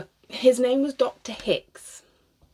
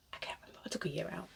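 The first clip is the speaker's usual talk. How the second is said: whispered aside; 3 semitones lower, 15 dB quieter, much faster than usual. Real, not acted. Muttered to herself.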